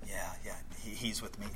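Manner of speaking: embarrassed, whispered aside; ashamed whisper. Pretend. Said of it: "he doesn't do it in shame".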